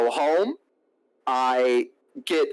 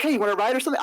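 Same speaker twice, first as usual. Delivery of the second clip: higher-pitched mimicry of the woman; imitated date; 9 semitones higher, much faster than usual. Date offering him a ride, imitated in a higher voice. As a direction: put on a high, casual offer.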